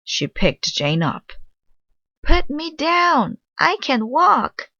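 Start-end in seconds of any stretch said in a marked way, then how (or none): 2.2-4.7 put-on high, slow childish scream. Little girl screaming at the helper to put her down. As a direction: shriek it like a kid being carried off. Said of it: "screamed Jane".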